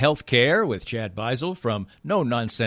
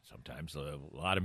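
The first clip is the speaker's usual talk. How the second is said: soft whispered aside; whispering; 6 semitones lower, 12 dB quieter than usual. Real, not acted. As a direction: drop to a whisper for this bit.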